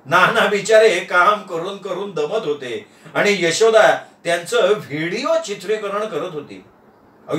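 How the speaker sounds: plain reading voice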